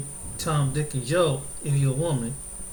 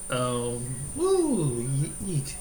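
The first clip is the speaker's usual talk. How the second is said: ordinary talk, nothing marked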